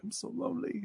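high, soft imitation of tearful self-pity; mock crying. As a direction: squeak it out as if starting to cry. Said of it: "starts to cry".

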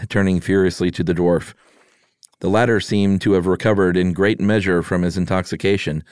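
ordinary delivery

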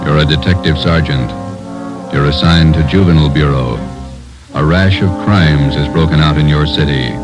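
ordinary talk, nothing marked

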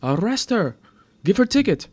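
loud, high-pitched angry demand; shouting. A narrator's reading. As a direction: shout orders at the officer. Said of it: "shouted the attendant".

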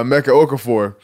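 normal talking voice

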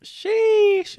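very high, loud, held squeal; drawn-out exclamation. Real, not acted. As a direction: stretch it out in a piercing falsetto. Drawn-out exclamation on being asked about week.

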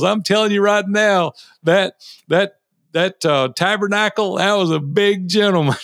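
hint of a preacher addressing a big crowd. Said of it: "he was up preaching in front of seven thousand people".

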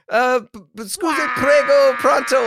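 high, stammering panic; acted-out panicked babble in Italian; flustered mock Italian. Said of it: "I'm just saying whatever I can in Italian".

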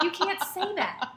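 high, slow imitation of a shocked teacher; mock scandalized. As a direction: scold in hammed-up disbelief. Acting the scandalized teacher's reproach.

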